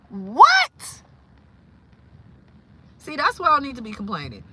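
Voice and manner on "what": high, loud rising yelp of disbelief; shocked. Real, not acted. Hearing a bone fell out of her finger, shocked. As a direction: blurt it out, aghast.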